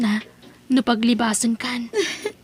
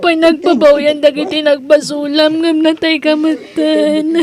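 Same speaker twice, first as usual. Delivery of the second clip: tearful, louder lament, last word slowed; grieving lament; 2 semitones higher, 4 dB louder, slower than usual. Acted. Edna laments over her dead uncle, tearful and pleading. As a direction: mourn him aloud through tears.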